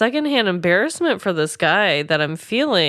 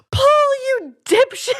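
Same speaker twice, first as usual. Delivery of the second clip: a much higher, mock-outraged jab at Paul; exasperated scolding. Imagining what she'd say to the robber, mock exasperated. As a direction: toss off the insult like a fed-up friend. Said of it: "I think I'd just be like".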